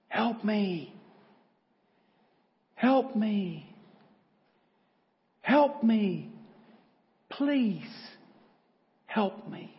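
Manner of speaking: desperate cry for help. Acted. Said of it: "crying out".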